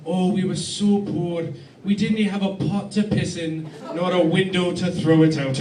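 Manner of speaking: lightly Scottish mum, slow and wistful; a hint of Glasgow, slowly lamenting; mom's Scottish voice. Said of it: "My mom used to describe it".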